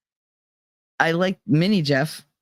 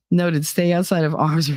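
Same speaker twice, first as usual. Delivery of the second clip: ordinary talk, nothing marked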